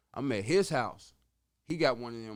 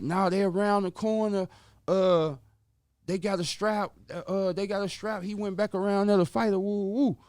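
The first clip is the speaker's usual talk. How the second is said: higher and louder, rushing the news; urgent warning; 6 semitones higher, 7 dB louder than usual. Retold or imitated. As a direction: blurt it out like the danger is right around the corner. Auntie warning about the strap.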